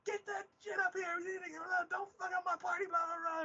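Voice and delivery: very high-pitched mock yelling; angry boss on modulator. Boss ordering guards over the voice modulator, high mock yell. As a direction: rant high as the boss, imitating. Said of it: "or the voice modulator is like".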